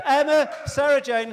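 calling out names. Real, not acted. Host calling the team to stand, calling out. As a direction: announce them over the room.

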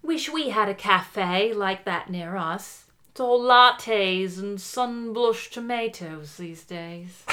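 slow, lightly voiced British mum; a hint of a wistful British lady; mildly put-on British accent, slowed down; Katie Rocket voice. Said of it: "Katie Rocket comes in".

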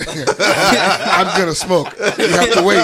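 loud, slow laughing words. Real, not acted. Laughing while lighting up.

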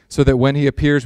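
ordinary talk

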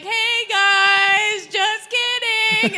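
loud, sing-song, drawn-out greeting; sheepish announcement. Retold or imitated. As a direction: sing out an awkward never-mind. Sheepish return to the trailer.

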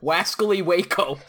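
cartoon hunter with w for r; giggly cartoon hunter impression; Elmer Fudd. Joker capping the hunter bit, laughing cartoon voice. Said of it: "sneaking up like Elmer, Elmer Fudd".

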